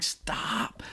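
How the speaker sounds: breathless whispered stop; stifling laughter in class, whispering to a friend; whispered plea. Retold or imitated. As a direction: hiss it to hold back a laugh. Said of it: "like, going".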